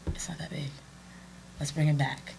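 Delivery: calm whisper. Retold or imitated. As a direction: hush her down like calming a child. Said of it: "he's genuinely calm".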